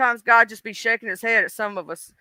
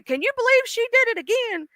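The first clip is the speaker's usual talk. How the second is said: high-pitched, softer mock disbelief; incredulous God; 12 semitones higher, 4 dB quieter than usual. God in conversation with Jesus about her, incredulous. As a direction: ask it way up high, scandalized. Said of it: "this is Jesus and God having a conversation".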